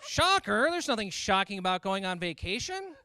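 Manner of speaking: loud high baffled dad; dad confused, high and loud. Retold or imitated. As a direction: bark the word back in disbelief. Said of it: "He's like".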